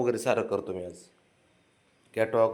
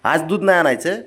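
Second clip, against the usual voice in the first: teasing pet talk; 6 semitones higher than usual. Real, not acted. Teasing the dog.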